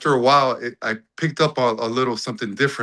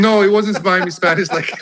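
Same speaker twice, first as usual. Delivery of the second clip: laughing, higher and louder; amused denial; 8 semitones higher, 7 dB louder than usual. Real, not acted. Answering a question about his Miami Spanish, laughing. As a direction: protest through a burst of laughter.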